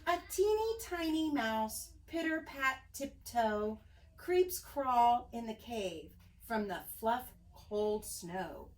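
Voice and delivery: usual speaking voice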